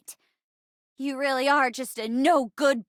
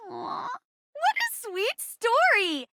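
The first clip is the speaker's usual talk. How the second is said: high, slow, drawn-out cooing; syrupy sing-song, pitched way up; gushing mockery; 8 semitones higher, much slower than usual. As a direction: coo it mockingly, stretching each word. Mocking reaction to a confession.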